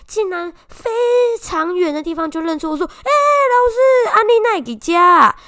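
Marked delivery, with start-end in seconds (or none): 2.9-5.3 much higher, louder, slow drawn-out eager call; imitating a boy's excited hello; enthusiastic greeting. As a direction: call out to the teacher from far away, thrilled. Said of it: "很热情的跟我打招呼".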